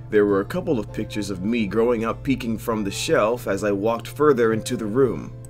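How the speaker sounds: ordinary delivery, nothing marked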